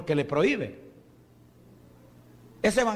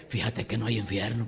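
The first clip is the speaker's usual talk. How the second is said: much lower, softer sly voice of the visitor; sly tempter; 11 semitones lower, 5 dB quieter than usual. Retold or imitated. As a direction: coax them like a smooth doorstep preacher. Voicing a Jehovah's Witness at the door.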